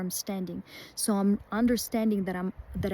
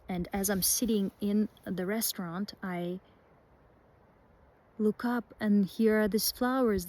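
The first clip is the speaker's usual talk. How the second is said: usual speaking voice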